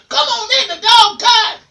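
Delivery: very high squawked parrot line. Acted. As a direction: squawk it like a talking bird. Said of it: "Missus Jones always said".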